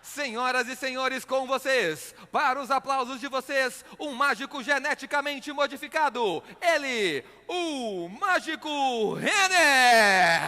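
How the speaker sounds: slow, building to a loud name; grand entrance announcement. Acted. Announces his own entrance.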